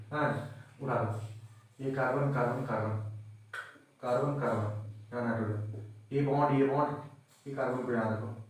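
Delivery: quiet muttering. Real, not acted. Muttering while drawing the structure.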